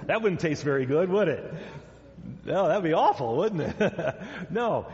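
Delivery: laughing between phrases; amused chuckling. Real, not acted. Preacher jokes with children about eating a Bible, chuckling. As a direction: chuckle at your own silly idea.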